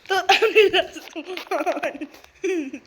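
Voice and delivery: giddy laugh-talk; can't stop laughing; laughing disbelief. Real, not acted.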